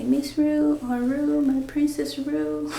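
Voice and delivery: put-on high, lilting coo to a baby; singsong baby-talk endearment; doting pet-name voice. Voicing pet names for baby.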